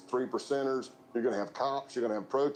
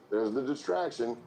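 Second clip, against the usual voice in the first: ordinary talk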